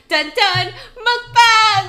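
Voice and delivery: sing-song high, loud fanfare; hype announcement. Real, not acted. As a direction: announce it like a drumroll. Announcing the video type.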